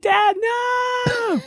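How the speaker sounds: very high shout of protest; panicked kid. Retold or imitated. Kid begging dad under the tornado, high panicked shout. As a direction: beg dad to stop. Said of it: "I'm like".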